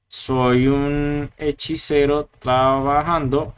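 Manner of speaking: slow, theatrical sorcerer voice; wizard voice. Pretend. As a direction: drawl it like a storybook wizard. Voicing the wizard's code output.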